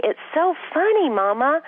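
put-on very high squeaky little child's voice; toddler voice. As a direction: play a giggly two-year-old. Mother voices her laughing toddler.

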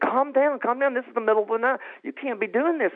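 high, urgent hushing of someone; mock nurse trying to calm someone; imitated nurse scolding. Acting out nurses scolding him.

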